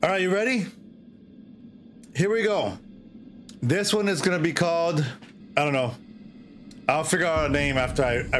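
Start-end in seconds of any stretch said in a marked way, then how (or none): none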